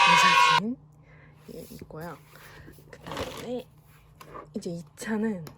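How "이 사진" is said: excited shout. Real, not acted. Photo where we screamed.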